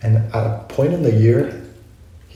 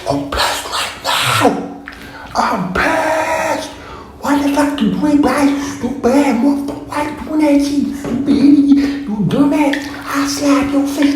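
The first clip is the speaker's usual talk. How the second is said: high-pitched furious mock rant, much higher than her own voice; freaking out. Retelling freaking out at a classmate's older brother, high mock fury. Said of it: "freaking out at this older brother".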